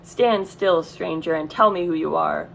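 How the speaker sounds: plain reading voice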